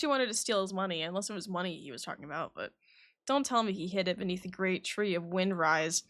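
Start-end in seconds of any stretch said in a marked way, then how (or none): none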